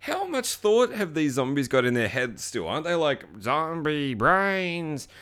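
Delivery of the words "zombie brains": slow dumb zombie voice. Retold or imitated. Mocking zombie thinking.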